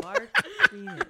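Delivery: echoing the name through a laugh; loud laughing repeat of the joke name; laughing disbelief. Real, not acted. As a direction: say it while cracking up.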